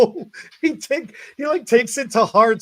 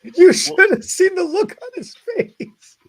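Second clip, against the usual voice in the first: laughing; 5 semitones higher, 5 dB louder than usual. Real, not acted. Cracking up recalling his reaction.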